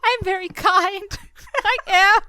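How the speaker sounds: high, loud, laughing insistence; mock protest. Real, not acted. Laughing host mock-insisting she is kind, high and loud. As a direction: protest your sweetness in play.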